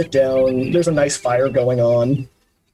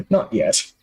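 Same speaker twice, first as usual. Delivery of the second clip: chuckling; 3 semitones lower than usual. Acted. Kindly man answering whether visitor is dead, chuckling. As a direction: let a small chuckle lead into the words. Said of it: "he chuckles a bit".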